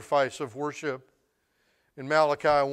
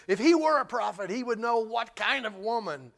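raised pitch, lightly voiced scorn; quoted religious disgust; 6 semitones higher than usual. Preacher quoting the religious about the sinful woman, lightly voiced disgust. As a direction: lightly imitate a disapproving critic, pitched up. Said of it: "by the religious with disgust".

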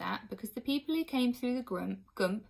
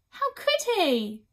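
high, loud, drawn-out outburst; queen crying out; 11 semitones higher, 8 dB louder, much slower than usual. A narrator's reading. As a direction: cry it out in disbelief, high and loud. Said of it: "cried the Queen".

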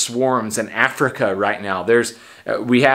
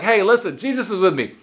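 higher, fearless declaration; bold confident; 7 semitones higher than usual. Pretend. Shopper with Jesus beside them, higher and fearless. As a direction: announce it without any fear. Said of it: "You'd be like".

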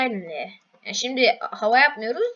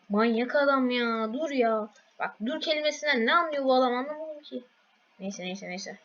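ordinary talk

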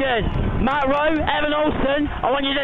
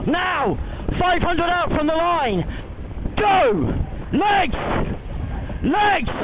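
shouting; 5 semitones higher, slower than usual. Real, not acted. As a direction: scream the crew through the push. Cox drives the sprint push.

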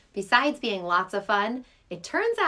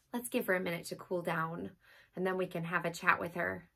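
quiet; 4 semitones lower, 6 dB quieter, faster than usual. Acted. Cat needs to cool down.

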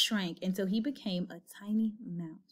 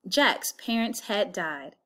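usual speaking voice

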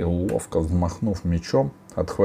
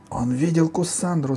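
high-pitched accusing cry; girl crying out; 11 semitones higher than usual. A narrator's reading. Girl accusing the ogre, high cry. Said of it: "закричала Алиса".

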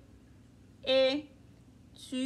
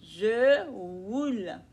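slow repeat; 3 semitones lower, much slower than usual. Shown as a demonstration. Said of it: "elle roule".

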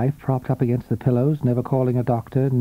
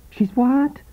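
high, raised question over noise; puzzled lover; 11 semitones higher than usual. A narrator's reading. Blonde lover straining to hear in disco, puzzled. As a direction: ask it over the music.

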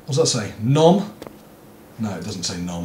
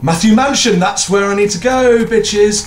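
higher, louder hyped call; excited announcement; 6 semitones higher, 4 dB louder than usual. Real, not acted. Player hyping himself up about the mansion, loud and high. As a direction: announce it with swagger.

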